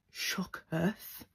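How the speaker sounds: hushed dramatic aside; stunned whisper. Real, not acted. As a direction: whisper it as if lost for words. Dramatic aside on everyone's shock.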